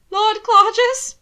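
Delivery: high, loud call down the hall. Acted. Alana calls out to Hannibal in the hallway. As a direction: shout for him from the bed. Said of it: "Alana called".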